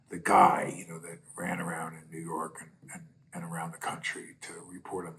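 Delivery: hushed whisper aside. Real, not acted. Telling a friend about the reporter in a soft whisper. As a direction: drop into a whisper as if sharing a secret.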